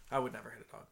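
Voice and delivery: usual speaking voice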